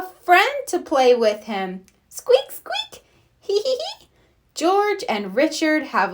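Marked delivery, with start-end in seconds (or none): 2.1-3.0 very high squeaking creak; squeaky seesaw. Acted. Seesaw creaking sound effect. 3.4-4.1 high little giggling; giggle. Acted. Children laughing on seesaw.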